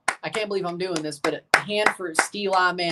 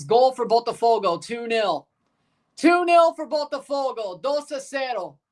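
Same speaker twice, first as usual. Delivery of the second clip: goal call; 5 semitones higher than usual. Acted. Calling the goal.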